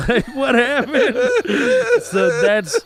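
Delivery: high, cracking through laughter; can't get words out for laughing; real laughter breaking the voice. Real, not acted. Laughing at own story.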